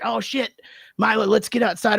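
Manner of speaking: urgent shout to the dog to get out; alarmed call to the dog. Retold or imitated. Owner calling his gagging dog out, alarmed. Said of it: "And I'm like".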